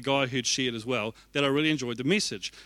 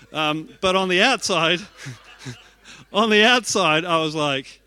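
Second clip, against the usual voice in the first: normal talking voice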